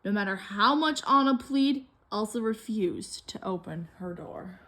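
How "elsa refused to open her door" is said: whispered, soft and sad; sorrowful hush; hushed narration. A narrator's reading. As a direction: drop to a whisper for the closed door. Sad moment, door shut.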